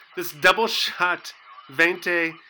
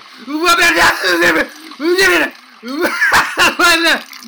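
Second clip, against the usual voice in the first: hysterical shrieking laugh; 12 semitones higher, 8 dB louder than usual. Acted. Breaking down at barista.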